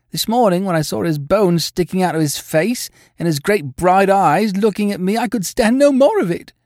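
high, agitated landlady voice; distressed landlady. A narrator's reading. Landlady telling how she insisted on a doctor, agitated.

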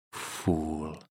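ordinary delivery

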